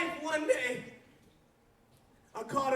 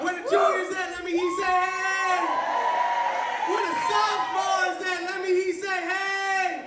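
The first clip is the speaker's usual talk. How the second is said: loud, higher rally shout to the crowd; hype call-out; 5 semitones higher, 9 dB louder than usual. Real, not acted. Pastor firing up each grade for a cheer, loud and higher. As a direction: pump up the room.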